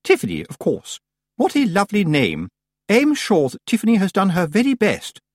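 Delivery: high, gushing condescension; sugary compliments, pitched high; patronising society witch. A narrator's reading. Missus Earwig talks down to young Tiffany, patronising.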